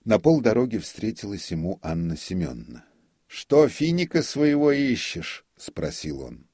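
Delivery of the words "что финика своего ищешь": much higher, louder sneering taunt; mocking jibe. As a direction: taunt her with loud scorn. Notary mocks his wife. (насмешливая, громкая и высокая издёвка)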